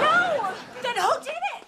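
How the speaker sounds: high, loud pressing question; alarmed demand. Acted. Mother demands to know who did it, loud and alarmed. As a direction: demand the truth.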